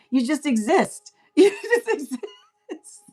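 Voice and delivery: high, laughter-filled punchline; laughing. Real, not acted. Reader cracking up at the answer to deniers, laughing. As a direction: laugh your way through the words. Laughing at her own point.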